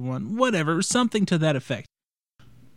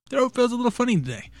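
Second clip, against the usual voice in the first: high puppet voice; 8 semitones higher, faster than usual. Acted. Sudden high odd voice.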